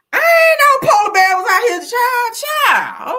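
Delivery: a shrieking, scandalized cry; high outraged shriek. Real, not acted. To her viewers about polar sausages, a high outraged shriek.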